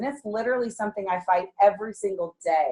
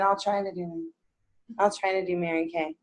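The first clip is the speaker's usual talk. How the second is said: soft, limp, half-hearted mumble; wimpy; 4 semitones lower, 8 dB quieter than usual. Shown as a demonstration. Mocking a timid seller's softer, wimpy promise. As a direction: say it like you don't believe it. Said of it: "That's wimpy".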